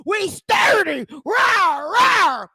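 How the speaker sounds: loud, high, growling mock chant. YouTuber mocking a rapper's stage entrance, shouted roar. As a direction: roar it out like a monster. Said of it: "Here come [name]".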